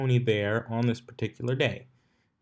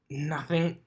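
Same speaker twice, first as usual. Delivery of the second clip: higher, nervous quick denial; 6 semitones higher than usual. Acted. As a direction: deny it too fast, jumpy. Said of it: "her sudden nervous tone".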